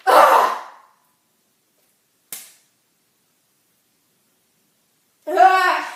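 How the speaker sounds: loud, explosive "ugh" of rage; two big groans of frustration; exasperated groan. Acted. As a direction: let out loud, disgusted groans. Furious groans after the call.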